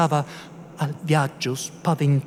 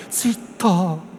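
hushed, angry shush on a raised pitch; low-voiced anger; 7 semitones higher than usual. A narrator's reading. Priest hushing his housekeeper about soldiers, angry undertone. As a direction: silence her crossly under your breath. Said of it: "rispose con voce bassa ma iraconda".

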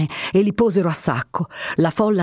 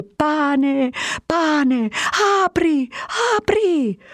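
crowd clamouring; 10 semitones higher, much slower than usual. A narrator's reading. Hungry crowd drowning out the captain, clamouring. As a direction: chant it like a mob.

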